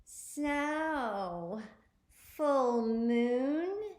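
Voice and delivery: high, loud, gleeful creepy neighbor; sinister grinning old lady; evil grin. A narrator's reading. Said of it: "with an evil grin".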